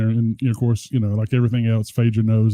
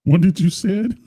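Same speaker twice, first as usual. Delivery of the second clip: higher, rising mock demand; echoed child impression; 6 semitones higher than usual. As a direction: lightly mimic an indignant little girl.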